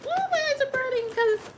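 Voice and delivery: crying high. Real, not acted. To Gubby while crying, high tearful squeal. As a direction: squeal through the tears. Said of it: "this boy got me crying".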